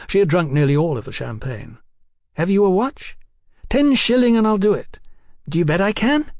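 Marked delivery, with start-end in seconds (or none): 2.3-6.3 put-on high, giddy voice of a tipsy young woman. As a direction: play her high and cheeky. Hetty voiced by narrator.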